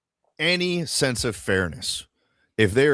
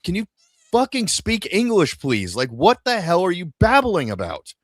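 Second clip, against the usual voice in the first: frustrated; 6 semitones higher, 3 dB louder than usual. Retold or imitated. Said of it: "I'm gonna be like".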